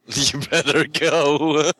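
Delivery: slow words broken up by laughter; laughing echo. Real, not acted. Co-host echoing the host to his son, laughing through it. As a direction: laugh your way through the line.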